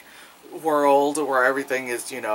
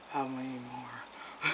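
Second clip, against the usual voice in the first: weary groan; 2 semitones lower, 16 dB quieter, slower than usual. Retold or imitated. Weary reaction to long film.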